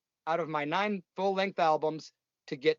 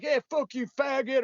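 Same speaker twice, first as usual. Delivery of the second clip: mock heckler taunt; 8 semitones higher than usual. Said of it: "because someone's like".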